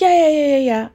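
high, loud run of yeahs; impatient high-pitched agreeing; dismissive fan. Retold or imitated. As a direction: imitate someone hurriedly brushing off a question. Imitates fan brushing off question.

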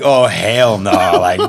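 loud laughing oh hell no; laughing alarm. Retold or imitated. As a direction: laugh it out in disbelief. Hearing guy has guns.

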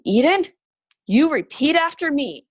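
retold mom giving orders; firm mother. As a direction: insist that the child follows your lead. Mother retelling firm command to daughter.

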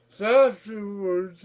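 ordinary delivery

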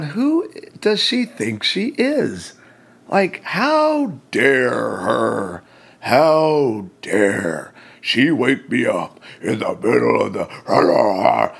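slow, drawn-out indignant outburst; angry rant. Retold or imitated. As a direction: act out mounting outrage, stretching the words. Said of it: "I'm getting angrier and angrier".

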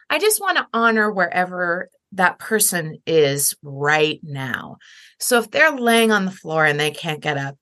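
ordinary talk

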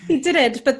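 animated, laughing protest; excited insistence. Real, not acted. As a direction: insist on it, amused and worked up.

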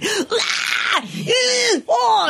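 high wailing cries; child's wailing cries. Retold or imitated. Teacher imitating the boy falling apart with high cries. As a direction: wail it out like a flailing toddler. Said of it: "literally going".

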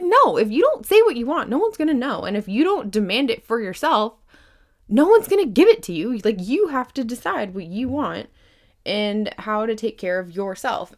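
high, loud, punchy rebuttal; emphatic insistence. Real, not acted. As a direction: drive every phrase home forcefully. Emphatic rebuttal of passive-aggressive voice.

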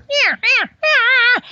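high loud cow elk mews. Retold or imitated. Hunter mimicking cows calling the bull, high loud mews. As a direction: squeal out the cows' calls.

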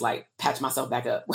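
ordinary talk, nothing marked

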